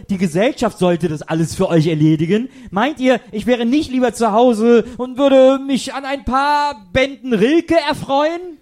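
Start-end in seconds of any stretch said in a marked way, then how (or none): 2.7-8.6 high, slow, offended lecture; lofty complaint, slowing on the last words; pompous indignation. Acted. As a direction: draw out Rilke with wounded pride. Indignant speech about Rilke.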